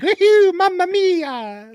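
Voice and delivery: high-pitched cartoon Italian whoop; Italian Mario impression. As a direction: stretch it out in a squealing, hammed-up Italian accent. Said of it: "Italian from Brooklyn is like".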